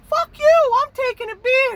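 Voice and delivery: put-on very high, loud drunken shriek; drunk yell. Rhoda yelling at the cooler owner, shrieking high. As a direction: screech it like a wasted idiot.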